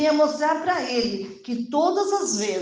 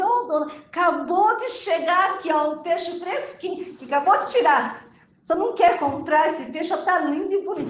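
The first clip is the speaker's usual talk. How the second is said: higher, eager market vendor; fish seller's pitch; 7 semitones higher, 3 dB louder than usual. Retold or imitated. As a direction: coax a customer like a street seller. (vendedor de feira oferecendo peixe, voz mais aguda)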